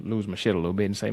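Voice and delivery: normal talking voice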